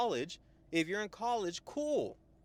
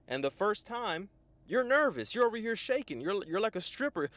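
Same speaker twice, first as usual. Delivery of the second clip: ordinary talk, nothing marked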